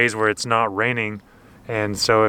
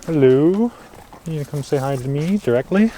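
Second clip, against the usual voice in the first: coaxing pet talk; 4 semitones higher than usual. Real, not acted. Greeting horse.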